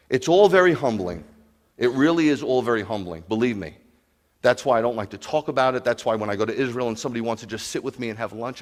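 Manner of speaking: ordinary talk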